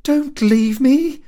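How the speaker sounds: high, loud, slow cry of a clinging wife; loud plea. A narrator's reading. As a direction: Carrie pleading loudly not to be left. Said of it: "said quite loudly".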